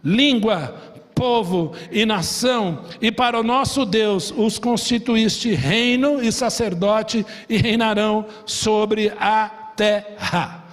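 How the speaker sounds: slow, solemn scripture reading. Acted. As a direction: read it out as holy text, slowly. Lê a escritura em voz solene. (leitura bíblica lenta e solene)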